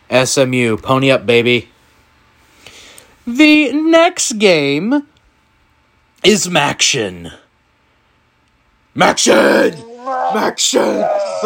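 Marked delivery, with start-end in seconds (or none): 3.2-7.3 announcer build-up. Acted. 8.9-11.4 hyped cheer. Real, not acted.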